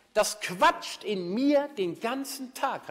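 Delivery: slow, high and loud, leaning on mir; emphatic complaint. Retold or imitated. Listener complaining about his chattering mind, slow and loud. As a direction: drag it out with exasperated emphasis. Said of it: "hat er gesagt".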